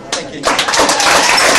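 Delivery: loud thanks. Real, not acted. Closing thanks to the crowd.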